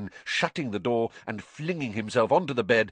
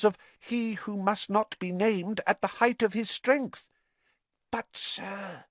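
high, anxious house-elf voice; a fretful little elf pleading; 10 semitones higher than usual. Acted. Dobby's elf voice.

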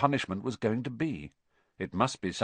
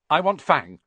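quick nervous claim; 6 semitones higher, 7 dB louder than usual. A narrator's reading. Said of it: "said Malfoy quickly".

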